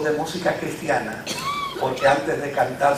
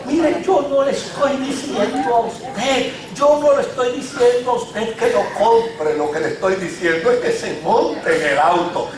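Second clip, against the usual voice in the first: a touch of nervous pleading, pitch raised; 5 semitones higher, 4 dB louder than usual. Retold or imitated. Mother pleading with Elvis at the dealer, mildly nervous. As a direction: mimic a flustered poor mother protesting. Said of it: "la mujer nerviosa decía".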